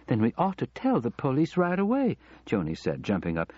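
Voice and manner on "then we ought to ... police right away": higher-pitched urging; a touch of alarm, pressing for action; urgent. A narrator's reading. Wife urging her husband to call police, urgent.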